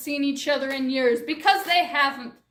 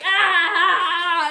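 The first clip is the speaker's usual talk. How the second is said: sung-out hammed-up yell; mock battle cry; 2 semitones higher, 5 dB louder than usual. Mother and daughter charge the creature, hammed-up battle cry. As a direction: belt a silly war cry.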